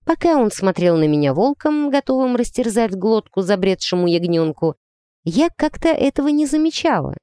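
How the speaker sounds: ordinary delivery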